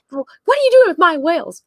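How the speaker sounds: high, loud imitation of outrage; hammed-up indignant demand; mock indignation. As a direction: confront someone as an outraged owner. Acting out Gillian confronting Spock.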